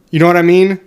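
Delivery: ordinary talk, nothing marked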